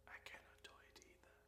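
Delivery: near-whispered confession to the listener; hushed aside. Real, not acted. Joking aside on lotus seat.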